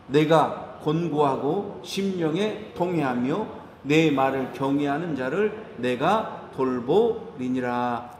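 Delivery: slow, low solemn Bible reading; scripture reading. Acted. Pastor reads Isaiah to church, slow solemn reading. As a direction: read the verse slowly, low and reverent.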